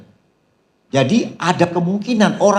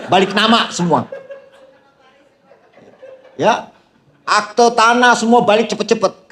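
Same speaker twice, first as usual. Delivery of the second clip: excited joking; 6 semitones higher than usual. Acted. Joking about shouting husbands.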